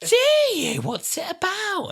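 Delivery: high, slow, eager colleague. Retold or imitated. Colleague asking about the podcast, high and eager. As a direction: stretch it out high like a keen colleague. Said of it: "And then they went".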